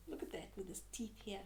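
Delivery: quick, hushed whisper. Acted. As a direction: whisper fast as if it might hear. Pointing at scary teeth.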